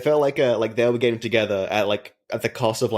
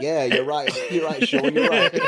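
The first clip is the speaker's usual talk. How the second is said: laughing mock reply; 6 semitones higher than usual.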